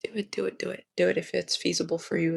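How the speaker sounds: ordinary talk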